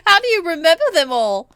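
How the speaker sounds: amused, laughing disbelief; laughing question. Real, not acted. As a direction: ask while still cracking up. Amused at long name list.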